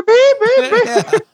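high bleep noise; mock censor beep. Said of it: "quit censoring us".

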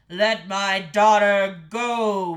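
very loud, slow bellow; bellowing king. A narrator's reading. King demanding the daughter's release, bellowing. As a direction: bellow each word as a command. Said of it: "bellowed the king".

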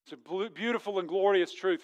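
ordinary delivery

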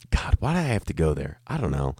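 usual speaking voice

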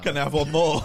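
cheeky request through laughter; laughing. Pretend. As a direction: ask it while cracking up. Pretend reply to heckler, laughing.

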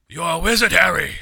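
loud booming Hagrid line; lightly voiced loud Hagrid; Hagrid impression. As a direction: announce it like Hagrid. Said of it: "fucking Hagrid busts in".

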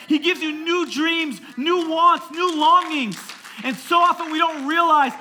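high, loud impassioned preaching; full-force preaching. Real, not acted. Pastor telling church about new desires, full-force preaching. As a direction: drive each phrase home at a shout.

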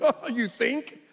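high, amused mock disbelief; retold incredulous retort; sarcastic doctor.

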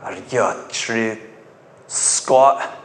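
low, slow mock voice of a nosy man; imitated suspicious stranger. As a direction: drag out the question, low and quiet, as the man who challenged you. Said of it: "I had this guy peer out ... and he said".

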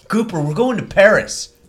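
nervous scene partner begging for a plan; anxious character. Acted. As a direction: fret like the plan has fallen apart.

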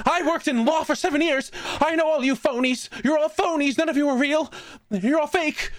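put-on high, frantic old woman accusing everyone; shrill panic of a lost old patient; confused old lady voice. Old patient confusing hospital staff, high frantic old lady voice. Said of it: "you gave her a Stamper voice".

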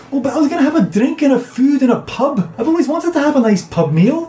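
high-pitched plaintive character voice; protesting companion. Acted. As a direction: whine in a high voice about the spoiled treat. Disappointed companion protesting being escorted away.

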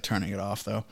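usual speaking voice

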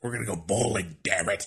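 lightly put-on wrestling boss; a hint of a cranky boss impression; Vince McMahon. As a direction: grumble like a mock wrestling promoter. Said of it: "Let me use my Vince McMahon voice".

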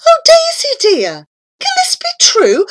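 high, loud astonished cry; high thrilled exclamation; amazed cry. Acted. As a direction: cry out in wonder at the sight. Narrator cries out to flying cow.

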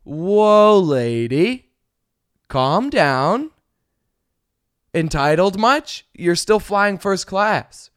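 sassy retort. Retold or imitated. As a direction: roll your eyes at her. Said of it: "sitting in my seat like".

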